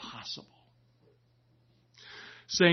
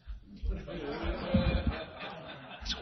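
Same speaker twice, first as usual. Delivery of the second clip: laugh; 4 semitones higher than usual. Real, not acted. Laughing at punchline.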